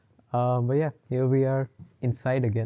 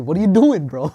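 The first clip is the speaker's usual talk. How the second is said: laughing tease; 6 semitones higher, 12 dB louder than usual. Real, not acted.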